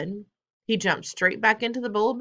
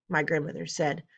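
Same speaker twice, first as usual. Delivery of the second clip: ordinary delivery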